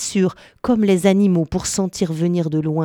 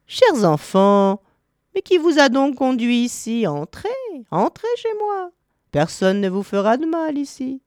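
put-on coaxing old woman; 7 semitones higher than usual. A narrator's reading. Old witch inviting the lost children into her house, coaxing. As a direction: lure them in with a high, lilting voice.

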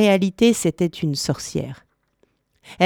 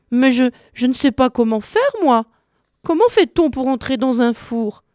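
high, hesitant feigned helplessness; innocent little girl; 6 semitones higher than usual. A narrator's reading. Crafty Gretel pretending not to know how to enter, innocent. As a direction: play dumb in a high child-like voice.

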